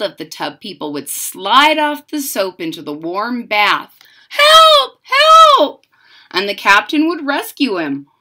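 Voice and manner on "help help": high, loud shouts for help; cry for help. Acted. Toy child calling out from the water, loud cry for help. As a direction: yell for rescue from the bath.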